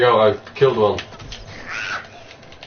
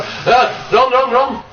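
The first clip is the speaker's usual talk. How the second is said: panicked scream, much higher and louder; being chased; 13 semitones higher, 4 dB louder, slower than usual. Real, not acted. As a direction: yell to run from a zombie horde. Zombie horde chasing them.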